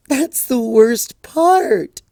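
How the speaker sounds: high, slow, tearful wail; sobbed. Acted. Twilight sobbing to her friends, high and slow. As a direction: drag the words out through tears. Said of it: "Twilight sobbed".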